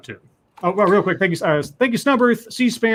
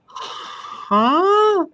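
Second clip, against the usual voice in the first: puzzled huh. Retold or imitated. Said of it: "kind of made me go".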